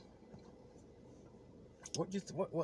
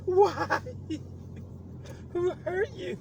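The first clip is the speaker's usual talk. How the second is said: mock concern; 14 semitones higher, 4 dB louder, much slower than usual. Teasing friend in mock concern.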